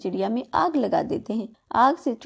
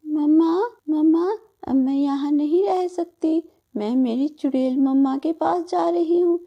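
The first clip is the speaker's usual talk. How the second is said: put-on higher, grieving little girl; heartbroken child; 5 semitones higher than usual. Daughter mourns and goes to her witch mother, high and sorrowful. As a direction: call for mama in despair.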